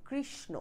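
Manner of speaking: কৃষ্ণ softly as krish-no; krish, then no, for কৃষ্ণ; n pronounced. Shown as a demonstration. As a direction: finish কৃষ্ণ on a clear no. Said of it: "ন উচ্চারণ করেছি".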